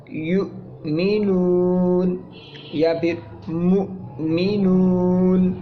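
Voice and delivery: یؤمنون and مؤمنون very slow, glottal stop after the first syllable; sakin hamza demo. Shown as a demonstration. Teacher demonstrating Arabic hamza to students, very slow. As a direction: pronounce مؤمنون with a catch in the throat before نون. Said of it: "حمزہ ساکن کی آواز نکلے گی".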